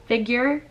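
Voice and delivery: "figure" with a y-glide, "fig-yer"; mispronounced ending. Shown as a demonstration. Vlogger showing viewers her slip, "figure" as "fig-yer". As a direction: isolate "figure" as the wrong ending. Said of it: "I said Tommy Hilfiger".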